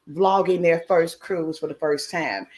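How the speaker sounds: usual speaking voice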